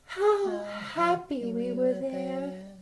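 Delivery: sadly. Pretend. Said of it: "so sadly".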